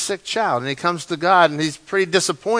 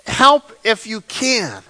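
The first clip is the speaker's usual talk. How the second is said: higher, slow, hesitant plea; doubtful plea; 5 semitones higher, slower than usual. Acted. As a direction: ask as if hope is almost gone. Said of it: "he's just saying".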